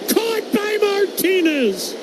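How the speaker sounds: high shouted "caught" for the catch; excited call. Real, not acted. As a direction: sell the catch with a high yell. Live call of diving catch.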